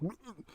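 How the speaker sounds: imitated queasy gulp, high and soft; mock retch. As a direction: mimic throwing up a little in the mouth. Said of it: "he kinda threw up in his mouth a little".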